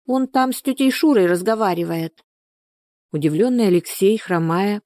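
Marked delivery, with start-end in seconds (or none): 0.1-2.2 put-on high child's voice explaining; narrator's high-pitched little-girl voice. A narrator's reading. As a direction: play a small girl explaining. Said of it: "ответила ему девочка".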